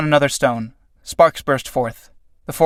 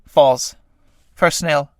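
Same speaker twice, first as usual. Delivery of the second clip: normal talking voice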